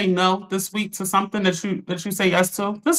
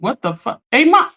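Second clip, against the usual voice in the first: ordinary delivery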